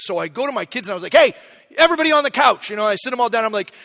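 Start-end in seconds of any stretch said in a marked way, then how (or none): 1.1-2.7 calling out. Retold or imitated. Dad calling kids together.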